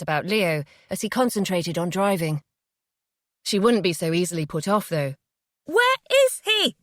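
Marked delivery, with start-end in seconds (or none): 5.6-6.8 high slow desperate scream; screaming at the top of her voice. Acted. Mother screaming at Jay for her son, full scream. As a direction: scream it, every word stretched. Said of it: "she screamed at the top of her voice".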